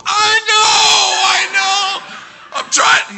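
very high screamed reply; loud, frantic yelling; hysterical panic. Retold or imitated. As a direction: shriek it in hammed-up hysteria. Tom hysterical over missing toe.

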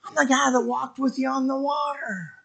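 high, soft mock plea; small pleading voice. Peter reminding the Lord of the water walk, soft high plea. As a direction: drop the volume and keep it high.

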